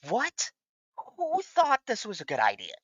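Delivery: high, stunned disbelief; incredulous. Real, not acted. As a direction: ask it as if you can't believe it. Outraged at the movie premise.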